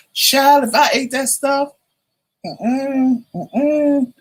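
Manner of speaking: high, scandalized sister voice; sister's disbelief. Retold or imitated. Sister says she couldn't eat that, high and scandalized. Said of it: "She was like".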